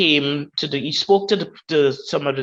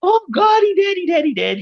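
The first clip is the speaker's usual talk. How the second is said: much higher, loud wailing cry of grief; screaming in grief; 4 dB louder than usual. Retold or imitated. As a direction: retell the mother's anguished scream at full voice. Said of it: "She was screaming".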